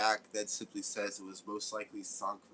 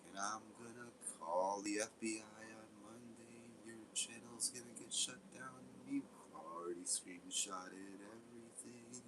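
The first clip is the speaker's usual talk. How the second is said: softer, mocking sing-song imitation of Toby; 5 semitones higher, 7 dB quieter than usual. Mocking Toby's FBI threat in a joke song, soft sing-song. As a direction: lightly parody his threat as a lazy song. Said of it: "we're just gonna sit there and be like".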